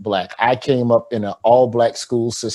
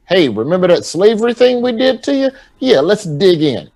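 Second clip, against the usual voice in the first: mock cheerful teacher; 6 semitones higher than usual. Mock teachers proposing slavery lesson.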